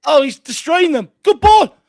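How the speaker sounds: excited shouting. Real, not acted. Attack on goal, shouting.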